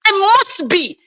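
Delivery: usual speaking voice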